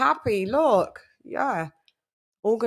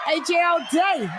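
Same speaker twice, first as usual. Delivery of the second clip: hype shout-out; 7 semitones higher than usual. Real, not acted. Hyped roll call shout.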